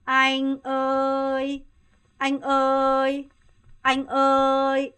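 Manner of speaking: 아잉어이 held level, slow and loud; level call. Shown as a demonstration. Teacher models calling older brother, level slow call. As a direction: keep 아잉어이 flat and stretched. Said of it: "평성 유지하세요".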